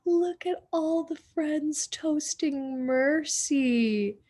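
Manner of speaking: plain reading voice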